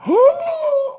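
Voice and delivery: a sharp, very high mock gasp; dramatic high gasp. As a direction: gasp in hammed-up shock. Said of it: "Here's Rosenstein".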